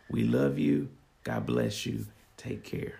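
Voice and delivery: ordinary delivery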